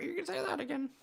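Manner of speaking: high, small parrot squawk; bird voice. Acted. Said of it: "It gets worse the longer I do it".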